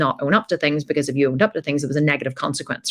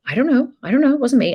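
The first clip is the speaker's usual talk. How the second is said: put-on high, squeaky kid denial; little girl denying she broke the vase; nervous child; 9 semitones higher, faster than usual.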